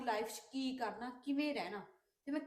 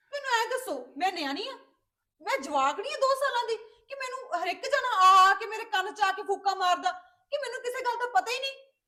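much higher and louder, indignant shouting; furious outburst; 9 semitones higher, 11 dB louder than usual. Acted. Sister refusing her brother's plea to calm down, loud indignant outburst. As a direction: hurl the questions at him, offended.

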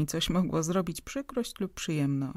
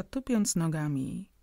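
plain reading voice

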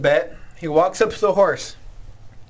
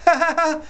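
horse laughing; 9 semitones higher than usual. Acted. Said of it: "But the horse laughs".

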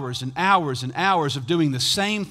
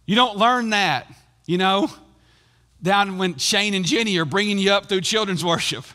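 usual speaking voice

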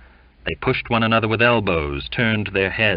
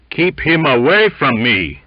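loud, raised warding-off; mildly alarmed, raised demand; baritone protest; 6 semitones higher, 7 dB louder than usual. A narrator's reading. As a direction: insist loudly on being left alone. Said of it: "in his fine baritone voice".

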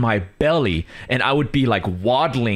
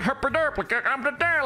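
silly high-pitched derpy gibberish; goofy babble; 4 dB quieter than usual. Retold or imitated. Joking about random Indonesian tweets, goofy high babble. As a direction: babble it high like a happy fool. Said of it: "I'm just going, like".

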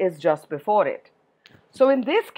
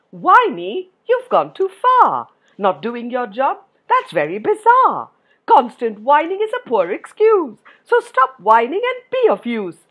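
higher, sing-song polite reprimand; mock polite scolding; 5 semitones higher, 6 dB louder than usual. Shown as a demonstration. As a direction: scold politely with exaggerated lilt, no yelling. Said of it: "said it like that".

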